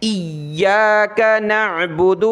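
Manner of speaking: ایاک نعبد with the یا in ایاک pressed hard and slow. Shown as a demonstration. Teacher showing the correct reading, ایاک with hard یا. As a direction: lean on the doubled یا in ایاک. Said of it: "یعنی اس کو سخت کرنا ہے".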